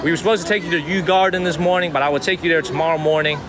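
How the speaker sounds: usual speaking voice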